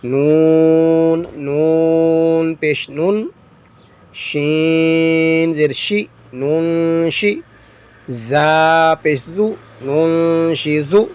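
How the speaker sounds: slow, drawn-out spelling in a reciting tone; measured recitation drill. Shown as a demonstration. Teacher spells Quranic words aloud for students, measured recitation. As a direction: chant each letter and vowel as if before a class.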